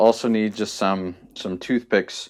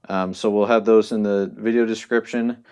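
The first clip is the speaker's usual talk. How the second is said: usual speaking voice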